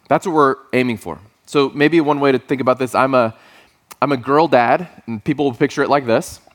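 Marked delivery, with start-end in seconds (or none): none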